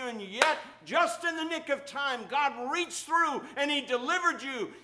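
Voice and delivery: building to loud, high fervor; rising preaching. Acted. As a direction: preach it up to a climax.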